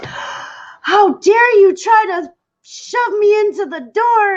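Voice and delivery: high, drawn-out fake outrage; theatrical 'how dare you' put-on; mock indignation. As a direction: accuse them in hammed-up shock. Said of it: "he pretends that I".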